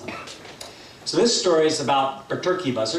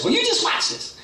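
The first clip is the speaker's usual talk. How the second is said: very high, cocky bird; Berchicken Hawk; 4 dB louder than usual. Acted. Hawk shows off before his dive, high cocky voice. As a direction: show off in a high squawk. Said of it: "Berchicken Hawk says".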